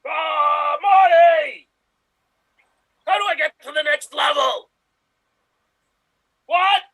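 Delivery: loud yell for Marty; shouting over machinery. Acted. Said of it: "Ready? Here you go".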